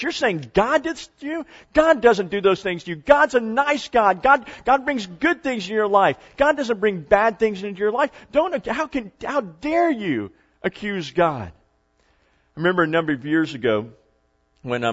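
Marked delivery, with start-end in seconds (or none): 0.0-11.5 high-pitched, indignant mock outrage; scandalized women. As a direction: scold in raised, horrified disbelief. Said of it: "all the women around her went like this".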